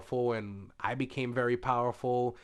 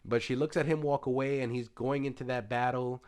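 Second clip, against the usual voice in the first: ordinary talk, nothing marked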